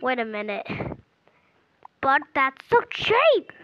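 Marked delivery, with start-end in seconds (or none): 2.0-3.5 loud, slow put-on complaint; protesting customer. Second customer protesting the seller's huge price, drawn-out mock indignation. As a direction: drag out the mock protest in a character voice.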